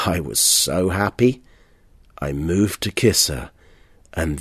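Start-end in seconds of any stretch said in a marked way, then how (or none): none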